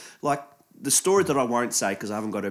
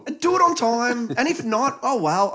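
mock chef; 13 semitones higher, 6 dB louder, slower than usual. Mocking chef attitude.

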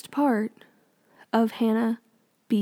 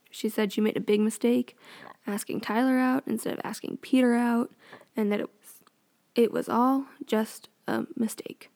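ordinary talk

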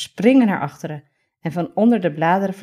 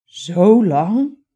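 surprised; 3 semitones higher, 8 dB louder than usual. Acted. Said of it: "Zegt Reiger verbaasd".

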